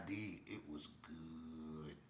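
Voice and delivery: slow, soft savoring. Real, not acted. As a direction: savor every word slowly. Savoring the memory.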